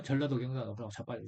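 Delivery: a quick, quiet throwaway repeat of 전라도, 경상도; muttered repeat. Real, not acted. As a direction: mumble the repeat quickly to yourself. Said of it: "예?".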